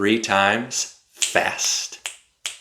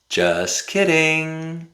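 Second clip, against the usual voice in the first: teasing; 7 semitones higher, slower than usual. Shown as a demonstration. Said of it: "when we're being funny".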